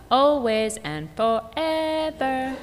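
put-on high sing-song child taunt; slow smug child. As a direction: drag it out to rub it in. Georgia rubbing it in.

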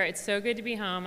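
ordinary delivery

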